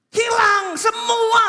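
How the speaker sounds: high, slow, booming proclamation; stretched loud pulpit cry; emphatic shout. Real, not acted. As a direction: shout it as the sermon's climax. Climax of listing Job's losses.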